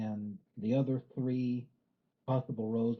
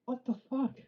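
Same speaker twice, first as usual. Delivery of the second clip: very high-pitched incredulous squeal; exaggerated baffled outcry, pitched way up; mock bewilderment in a squeaky high voice; retold disbelief; 14 semitones higher, slower than usual. Retelling own baffled reaction.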